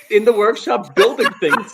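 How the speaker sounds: amused, drawn-out high delivery with laughter; laughing through it. Real, not acted. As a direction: crack up while picturing the joke. Joking, both cracking up.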